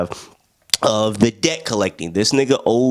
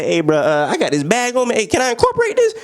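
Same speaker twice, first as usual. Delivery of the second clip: mock [name] pitch; 10 semitones higher than usual.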